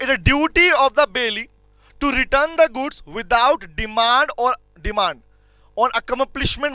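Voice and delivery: very slow reading of the provision; dictating the section slowly to students; slow dictation. Shown as a demonstration. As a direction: read it out word by word. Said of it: "reading the provision slowly".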